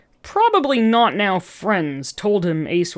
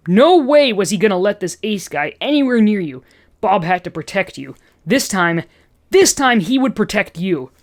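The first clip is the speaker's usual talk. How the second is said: plain reading voice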